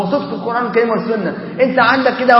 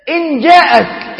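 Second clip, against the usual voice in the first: higher, slow and shouted; rising sermon exclamation; preacher's shout; 5 semitones higher, much slower than usual. Acted. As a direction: call it out to the congregation. Raises the voice for emphasis.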